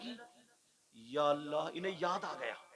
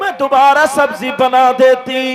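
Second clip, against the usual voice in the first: loud, high fervent sermon climax; preaching at full force; 8 semitones higher, 25 dB louder than usual. Acted. Preacher rousing the gathering, loud fervent preaching. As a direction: drive the story home at full force.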